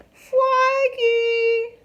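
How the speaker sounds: very high, loud call; a little sister shouting up the tree. A narrator's reading. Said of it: "called Polly Woggalina".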